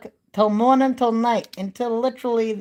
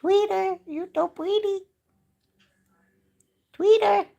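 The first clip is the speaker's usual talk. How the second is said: high, loud birdy chirping voice; 12 semitones higher, 4 dB louder than usual. Real, not acted. Talking to her parakeets in high cooing baby talk. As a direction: coo and tweet up high at the birds. Talks to her pet birds.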